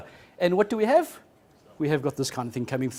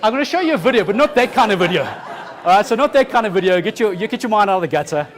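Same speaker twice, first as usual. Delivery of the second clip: loud, higher-pitched amused protest; mock scolding; 4 semitones higher, 9 dB louder than usual. Real, not acted. Speaker correcting the audience's dirty minds, loud mock scolding. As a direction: raise the voice over the audience's laughter. Talks over audience laughter at innuendo.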